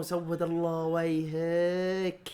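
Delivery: drawn-out, mocking curse; slow mock curse. Real, not acted. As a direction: stretch every word out in mock disgust. Fan mocks a player.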